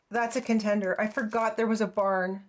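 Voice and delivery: usual speaking voice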